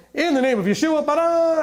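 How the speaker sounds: mocking magic-word delivery; mock magic incantation. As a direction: raise it up like a magic spell, ending in a flourish. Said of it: "it's like magic".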